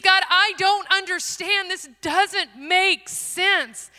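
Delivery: loud, high protest in retold prayer, stretched at the end; anguished plea. Missionary telling God she doesn't understand, loud anguished pleading.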